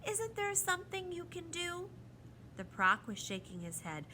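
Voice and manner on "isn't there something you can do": put-on high, plaintive child's voice; tearful pleading. A narrator's reading. Lindy begs the proc for help, tearful. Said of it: "Huge tears rolled down Lindy's face".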